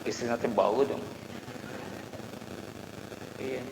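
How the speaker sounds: ordinary delivery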